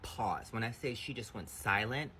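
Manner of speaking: ordinary talk, nothing marked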